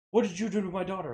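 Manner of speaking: fast, sharp mock confrontation; quick accusing demand at the door; retold angry dad. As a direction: fire it off like an angry father.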